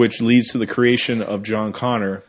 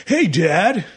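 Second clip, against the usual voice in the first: California surfer; 7 semitones higher than usual. Retold or imitated. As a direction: toss it off like a laid-back California surfer. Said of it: "like, a surfer, California".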